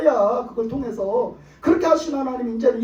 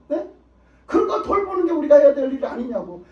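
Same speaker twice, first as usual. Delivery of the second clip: ordinary delivery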